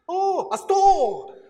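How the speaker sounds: the taxi driver suddenly gets it, loud and high; sudden recognition. Retold or imitated. Taxi driver finally understanding the hotel name, delighted loud exclamation.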